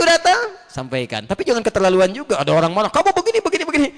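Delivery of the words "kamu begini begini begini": mock angry complaint. Mimicking an angry person.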